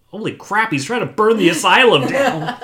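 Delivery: high, loud cry breaking into laughter; hammed-up horrified realisation, laughing; mock alarm. Joking that Jay is burning the asylum, laughing mock alarm.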